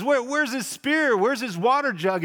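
high, loud mocking question; taunting call. David taunting Abner from the hill, loud and mocking. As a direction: jeer at him from the hill. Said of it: "He says".

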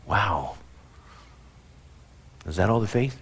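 low, soft, let-down wow; disappointed. Acted. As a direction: sag into quiet disbelief. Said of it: "the other spectrum".

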